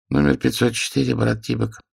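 bored clerk calling a number; flat officious bureaucrat. A narrator's reading. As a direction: drone the file number. Clerk calls out next number.

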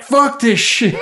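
high-pitched retold outburst, breaking into laughter; mock outburst. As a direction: squeal it, tipping into a laugh. Quotes own reaction, laughing.

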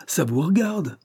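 high, swooping retort; insistent cry. A narrator's reading. Voice from the landing challenging the boss, insistent cry. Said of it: "crie une voix".

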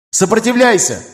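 high strained call to resist; urgent shout. Acted. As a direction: plead loudly with a dying friend. Urging dying ally to fight.

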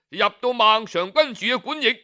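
ordinary delivery, nothing marked

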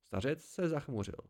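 plain reading voice

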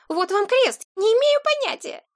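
very high, pitiful protest; plaintive boy. A narrator's reading. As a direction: swear innocence in a squeaky plaintive voice. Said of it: "жалобно проговорил мальчишка". (жалобно, очень тонким голосом)

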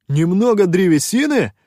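high, loud, outraged echo; indignant exclamation. A narrator's reading. Шутай challenges the pirate's claim, indignant. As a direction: throw the phrase back in outrage. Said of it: "возмущённо воскликнул Шутай".

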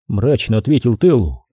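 usual speaking voice

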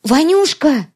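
sudden high shout of the name; shout of recognition. A narrator's reading. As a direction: cry out his name in shock. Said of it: "закричала не своим голосом".

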